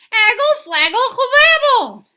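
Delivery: very high, loud, slow babbling; toddler babble. Acted. Toddler pleading with her daddy in babble, high and loud. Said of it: "said Trixie again".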